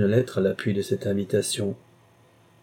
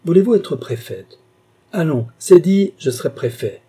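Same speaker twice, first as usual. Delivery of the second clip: carried-away exclamation; 7 semitones higher, 7 dB louder than usual. A narrator's reading. Carquebus carried away promising Clémence a prefecture, loud exclamation. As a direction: proclaim it, swept away.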